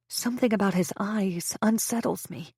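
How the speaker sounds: ordinary delivery, nothing marked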